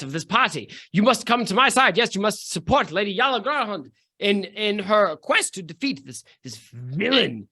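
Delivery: high, sweeping lady's voice; grand dame, high and theatrical; posh noblewoman voice. Acted. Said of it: "Julie Andrews, are you trying to mimic there".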